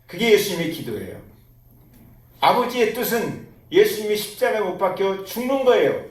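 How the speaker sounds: ordinary talk, nothing marked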